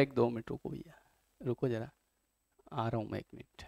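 very quiet, low voice; hushed, low call to wait, as if from offstage; William Shakespeare. Acted. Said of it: "बोले". (बहुत धीमी, दबी आवाज़ में रुकने को कहना)

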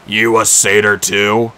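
a giant checking the menu; slow Cyclops. A narrator's reading. Cyclops asks Clarisse.

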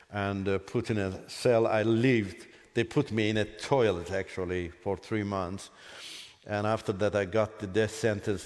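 ordinary talk, nothing marked

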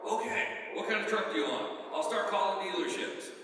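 retold dad keen to help, a notch up; eager dad.

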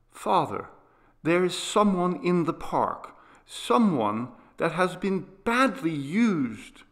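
put-on high, urgent child; earnest sick boy. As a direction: confide the secret with pleading urgency. Boy reveals his secret.

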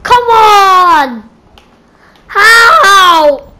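loud, high wail of protest; exasperated yelling at the game; dismayed shout. Real, not acted. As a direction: cry out at an unfair death. Killed again in game.

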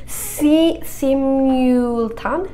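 slow, broken attempt at a French word; stretched syllables, testing each one; struggling to pronounce. Real, not acted. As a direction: sound it out haltingly, unsure. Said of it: "I can't say that one".

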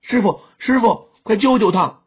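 high, urgent call for help; panicked cry. A narrator's reading. Youth begs his teacher to save the fainted girl, panicked. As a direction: plead frantically for help. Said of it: "黄急大叫".

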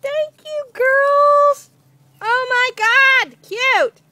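high, loud, delighted squealing over a gift; thrilled squeal. Real, not acted. Mom thanking the girls, squealing with real delight. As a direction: gush with real joy, voice way up.

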